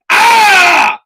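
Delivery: long, high, loud yell of exasperation; frustrated scream. Real, not acted. Fed-up dad venting on his podcast, frustrated scream. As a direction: let the frustration burst out in one scream. Frustrated outburst in angry rant.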